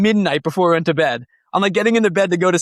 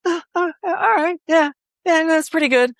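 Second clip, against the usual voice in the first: higher, hesitant mock-unimpressed voice; lukewarm Maria; 12 semitones higher than usual. Guitarist imitating his partner hearing the new tone, higher and lukewarm. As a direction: play her shrugging, half-impressed approval. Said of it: "she comes and she's like".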